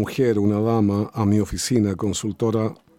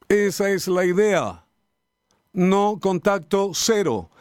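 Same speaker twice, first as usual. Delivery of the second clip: higher, pressing sermon voice building on each creer; fervent preaching; 6 semitones higher than usual. Acted. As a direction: preach it, lifting the congregation. Pastor preaching with rising fervour.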